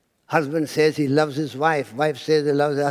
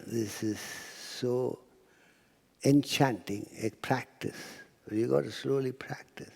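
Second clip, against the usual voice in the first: whispered; 4 semitones lower, 8 dB quieter than usual. Real, not acted. Whispered advice to audience.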